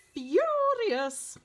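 furious said high and loud; stress on furious. A narrator's reading. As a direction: punch the word furious. Narrator stresses angry word.

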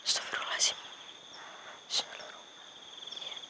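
voiceless whispered prayer. Real, not acted. Ghost hunter whispers a prayer before the spirit. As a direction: murmur it under the breath.

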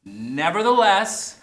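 deliberate "Nevertheless" together; slow unison. Shown as a demonstration. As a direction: lead the word slowly. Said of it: "Here we go".

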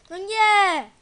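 loud high cry of dismay; shouting. Real, not acted. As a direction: yell it out in shock. Game mishap outburst.

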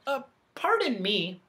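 tentative interjection over someone talking; polite interruption. A narrator's reading. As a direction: butt in politely. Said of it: "interrupted Milo".

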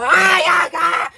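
high, loud, drawn-out shriek; long shriek of frustration; exasperated scream. Acted. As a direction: scream over the racket. Noisy quarrel drives cellmate mad.

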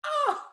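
high sharp mock "ah" of pain; imitated stubbed-toe cry; mock pained yelp.

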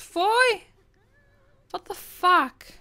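high loud fuj, then slow what the fuck; outraged yuck; disgusted shout. Real, not acted. Player yells in disgust at leftover food. More mess found.

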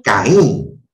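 "Caín" with the second vowel punched, rising then falling; stress on final vowel. Shown as a demonstration. Teacher showing the class where the voice's force falls in "Caín", stressed final vowel. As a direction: lean hard on the í in "Caín". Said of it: "La mayor fuerza de voz está resaltando la vocal cerrada".

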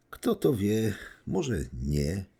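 plain reading voice

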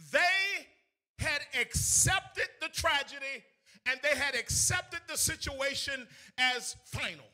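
loud, high, chanted preaching; sermon sung out over the organ; preaching at full force. Acted. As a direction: whoop it up like a Sunday preacher.

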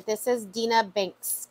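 ordinary delivery

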